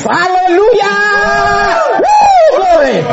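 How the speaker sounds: high, loud cries of praise; shouting hallelujah with joy; jubilant shout. Real, not acted. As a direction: burst out rejoicing at full voice. Preacher's exultant outburst.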